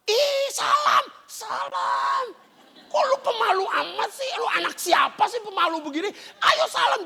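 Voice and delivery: high-pitched exasperated scolding; mock fussing parent, impatient and high; nagging parent. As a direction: push a shy child to greet, voice way up. Acted parent nagging shy child.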